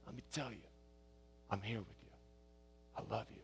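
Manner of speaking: put-on hushed and loving, like soothing a child; gentle comforting voice. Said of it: "in a gentle, comforting voice".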